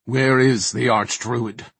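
slow, forceful questioning; demanding. A narrator's reading. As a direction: insist on an answer, loudly. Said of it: "Mordant demanded".

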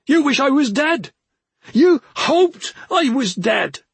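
high, loud, hammering each word; screamed accusation. A narrator's reading. Brother accusing his sister of wishing him dead, screaming. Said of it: "he screamed at her".

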